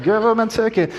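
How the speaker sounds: high-pitched mock heckle; playful imitation of a jeering passer-by; joking heckler imitation. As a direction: toss it out as a family catchphrase. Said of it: "which now is a joke in our house".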